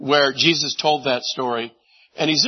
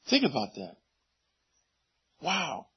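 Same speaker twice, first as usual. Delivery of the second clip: ordinary delivery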